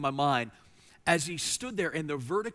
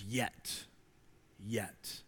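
hushed yet; 5 semitones lower, 7 dB quieter than usual. Acted. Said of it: "he says".

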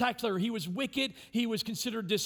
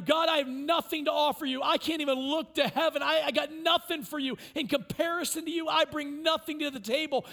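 louder, higher pleading cry; crying out to God; 5 semitones higher, 4 dB louder than usual. Acted. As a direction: plead with God, pitched up and loud. Voicing the tax collector's cry.

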